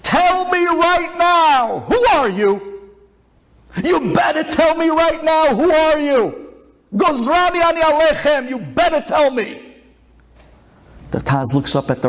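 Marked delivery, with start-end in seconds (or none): 0.0-9.6 yelling. Acted. Rabbi confronts the man on the floor, yelling. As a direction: yell a furious demand, pitched way up. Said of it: "he starts yelling".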